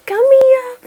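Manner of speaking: high, slow cooing pet voice. Real, not acted.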